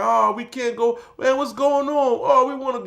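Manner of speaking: mock whine of disappointed prisoners; whining inmates. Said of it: "They're like".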